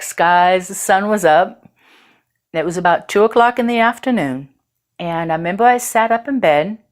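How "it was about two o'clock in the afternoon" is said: ordinary delivery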